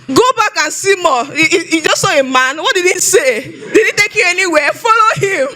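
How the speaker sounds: high-pitched, insistent questioning; imitated urgent coaching. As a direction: play a pastor pushing hard, pitch climbing.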